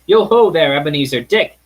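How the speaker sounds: a rich, hearty hail across the warehouse; jovial call. Acted. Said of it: "in a comfortable, oily, rich, fat, jovial voice".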